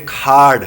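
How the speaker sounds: کھاڑ with a breath-puffed K and long vowel; accented pronunciation. Shown as a demonstration. Teacher showing accent plus pronunciation, aspirated K. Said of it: "اس میں accent بھی ہے، pronunciation بھی ہے".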